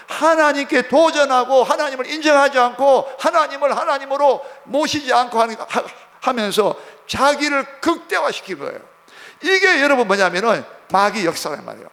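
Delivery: high, loud sermon delivery; forceful preaching. Real, not acted. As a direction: preach it out hard, phrase upon phrase.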